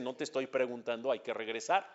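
low, quiet ultimatum; lightly voiced dad laying down the law; stern father. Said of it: "el papá pone un golpe sobre la mesa y dice".

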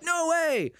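high, drawn-out retold disbelief; mock amazement. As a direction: replay amazement with pitch shooting way up. Retelling his amazed reaction.

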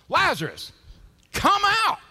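full, high-pitched commanding shout; Jesus. Acted. Calling Lazarus out of the tomb, huge shout. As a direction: bellow it to summon the dead. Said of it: "Then Jesus shouted".

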